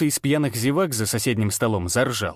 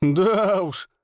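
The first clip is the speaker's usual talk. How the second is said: wry showman; 7 semitones higher than usual. Acted. Ranner's curt reply.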